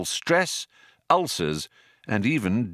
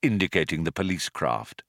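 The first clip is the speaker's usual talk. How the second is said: usual speaking voice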